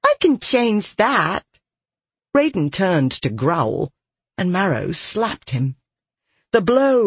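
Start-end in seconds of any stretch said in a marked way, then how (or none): 0.0-1.4 put-on slow, higher girlish voice; giggly girl. A narrator's reading. Girl offering her power to a soldier, playful. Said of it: "Marrow giggled".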